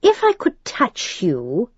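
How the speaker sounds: put-on precise demon accent. A narrator's reading. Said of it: "his accent clear and precise".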